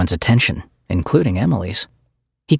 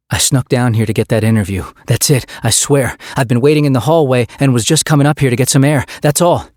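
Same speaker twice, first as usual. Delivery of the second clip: rushed, louder, higher excuses; frantic pleading; 4 semitones higher, 4 dB louder than usual. Acted. Derek pleading with Stryker to believe him, rushed and louder. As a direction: babble the excuses fast.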